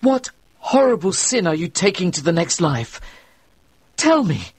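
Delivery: put-on frightened young reverend demanding the truth; urgent demand. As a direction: press the dying man for an answer.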